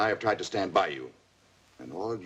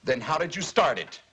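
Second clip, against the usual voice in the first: angry accusation; 9 semitones higher than usual. Acted. Father accusing daughter.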